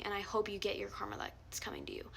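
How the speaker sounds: low unvoiced whisper of ill will; whispered curse. Real, not acted. To Poet after calling him terrible, a whispered curse. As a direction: hiss the wish under your breath.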